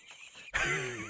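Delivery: high snickering cartoon-dog laugh; wheezy cartoon laugh. Real, not acted. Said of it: "did you hear that laugh?".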